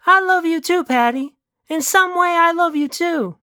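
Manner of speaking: put-on high-pitched girlfriend voice; high character voice, affectionate; fond Elena. Plays Elena answering Patty.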